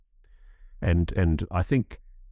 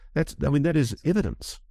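usual speaking voice

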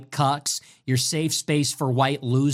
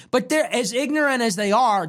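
normal talking voice

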